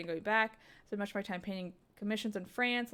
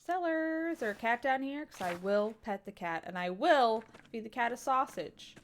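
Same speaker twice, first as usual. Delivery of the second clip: ordinary talk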